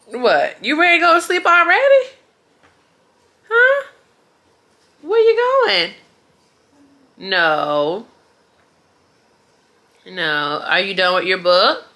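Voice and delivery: put-on singsong questions to a baby; talking to a toddler.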